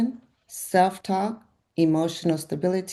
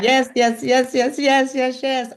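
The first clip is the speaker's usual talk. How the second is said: yes after yes, each one higher; excited cheering; 6 semitones higher, 4 dB louder than usual. Real, not acted. Coach cheering her client's weight loss plan, rising excited yeses.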